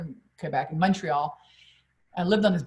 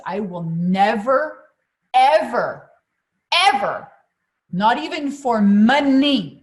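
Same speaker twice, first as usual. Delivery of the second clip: slow, louder, each ever pushed higher; defiant vow; 4 semitones higher, 8 dB louder, much slower than usual. Retold or imitated. Woman vowing never to feel bad again, loud defiant build. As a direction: swear it to yourself with rising force.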